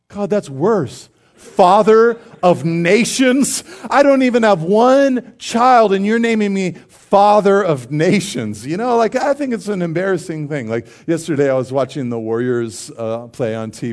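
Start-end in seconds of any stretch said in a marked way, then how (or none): 0.1-8.7 higher, louder, slow aghast complaint; exasperated protest. Retold or imitated. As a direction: protest to God in disbelief. Abram protesting new name.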